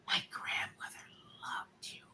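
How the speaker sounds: very soft, halting whisper; confiding whisper. Retold or imitated. Young fan telling a celebrity about grandmother, whispering. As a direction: whisper it like a secret. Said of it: "and she's whispering".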